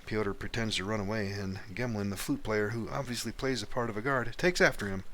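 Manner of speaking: ordinary talk, nothing marked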